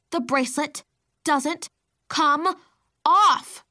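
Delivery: rising strained shout; straining. A narrator's reading. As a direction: push the words out while struggling. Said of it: "I repeated between attempts to stand".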